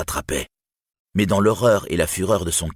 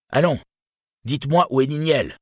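raised, high urgent demand; crying out; 9 semitones higher, 4 dB louder than usual. A narrator's reading. Turin to the silent crowd, crying out impatiently. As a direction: cry out for an answer. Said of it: "s'écria-t-il".

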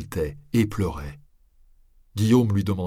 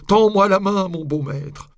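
"Tends-moi la main" cried out high and loud; desperate ghostly cry; 12 semitones higher, 7 dB louder than usual. A narrator's reading. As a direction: cry out the plea from hell. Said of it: "voix sépulcrale".

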